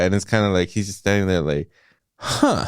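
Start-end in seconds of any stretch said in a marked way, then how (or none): 2.2-2.7 sharp high confused huh; a baffled, high-pitched grunt of shock; dumbfounded father's huh; stunned huh. Retold or imitated. Dad stunned, confused at table.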